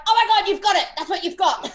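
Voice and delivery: high, loud excited outburst; excited realisation. Retold or imitated. Daughter tells psychologist dad he has it, retold excited outburst. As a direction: burst out with the discovery, laughter creeping in.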